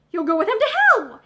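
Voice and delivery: loud angry outburst at the servant; exclaimed. Acted. Master snapping at Hareton, loud angry exclaim. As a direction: fling the curse at him, loud. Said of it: "exclaimed his master".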